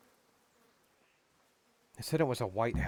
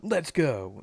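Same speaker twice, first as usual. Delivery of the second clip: louder, higher echoed call; mock rallying call; 4 semitones higher, 6 dB louder than usual. Streamer echoing characters who then just stand there, mock rallying. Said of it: "And they just stand there".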